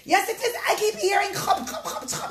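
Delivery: loud, high shouted insistence with a laugh; raving king. Acted. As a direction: rave at the servant. King arguing with servant.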